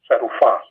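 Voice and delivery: serufah with the stress on the final syllable, fah; stress on last syllable. Shown as a demonstration. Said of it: "it's accented on the last syllable".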